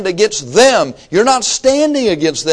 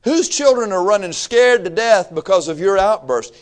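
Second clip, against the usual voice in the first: usual speaking voice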